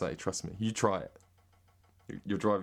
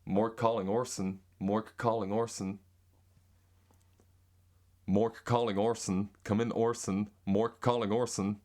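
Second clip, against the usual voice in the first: slowish, practised American accent. Shown as a demonstration. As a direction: drill the line in an American accent. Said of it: "Here's American".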